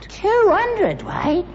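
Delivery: soaring, slow disbelieving squeak; stunned, stretch it high; astonished. Real, not acted. Father hearing of the lead, astonished high echo.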